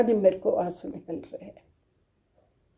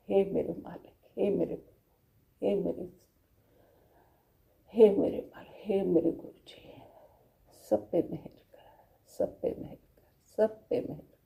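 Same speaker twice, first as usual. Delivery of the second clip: much softer, devoted pleading murmur; hushed prayer; 8 dB quieter than usual. Real, not acted. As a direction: pray softly for blessings on all. गुरु से मेहर की प्रार्थना. (धीमी आवाज़ में विनती)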